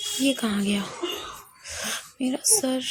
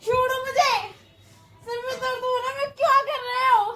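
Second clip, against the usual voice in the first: frightened pleading; 8 semitones higher than usual. Acted. Victim struggling, head hurts.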